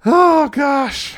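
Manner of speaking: high loud oh gosh; shocked. Real, not acted. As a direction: cry out in shock. Shocked exclamation.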